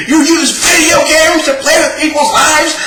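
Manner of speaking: mock Emu shout. Mimicking Emu's catchphrase as a high shout. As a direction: yell it like a preachy hero. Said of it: "how many fucking times he said".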